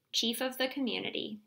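ordinary delivery

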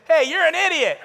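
put-on high, loud child voice with a laugh; kid voice. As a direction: call out like a cheeky little boy. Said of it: "I hear him say".

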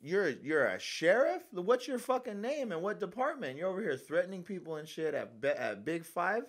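high, loud challenging rant; angry confrontation. Retold or imitated. Confronting the so-called sheriff at the store, heated high rant. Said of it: "I was like".

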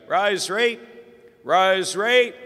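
loud, high call to the family. Retold or imitated. Uncle calling the family to the rosary, loud call. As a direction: summon everyone to prayer.

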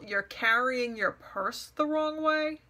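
slow, drawn-out disbelieving question; incredulous echo. Retold or imitated. Questioning the nonsense criticism she was given, incredulous mock echo. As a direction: repeat it slowly as if it were absurd. Repeats remark as absurd question.

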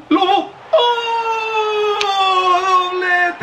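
shrieking high goal celebration; breakneck goal commentary. Real, not acted. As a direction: scream it like a TV commentator. Second goal scored.

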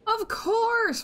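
high, exasperated outcry at the screen. Real, not acted. Gamer yells at a lucky opponent, high and exasperated. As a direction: throw your voice up in disbelief.

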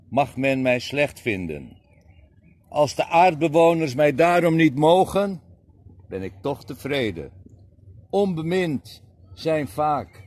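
declaimed poem, slowing and sinking at the close; poem recital. Acted. Poet reciting Anwari to the audience, declaimed. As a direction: recite it like a Sufi love poem.

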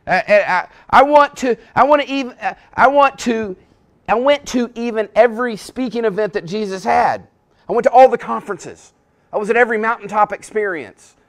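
loud, high, breathless boasting; bragging churchgoer. Acted. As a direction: trip over yourself to show off. Boastful hearer keeps bragging.

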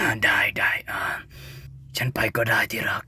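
breathy sheepish whisper. Acted. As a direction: whisper the retreat meekly. Intruder backs off after being yelled at.

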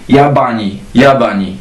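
Jabani read the Arabic way, stress on BA; Arabic pronunciation. Shown as a demonstration. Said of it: "daje nam dźwięk i". (arabska wymowa słowa Jabani)

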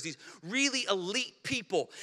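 ordinary delivery

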